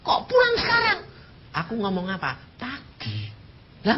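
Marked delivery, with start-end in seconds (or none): 0.0-1.0 shocked cry. Retold or imitated. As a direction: protest in disbelief. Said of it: "aku bilang".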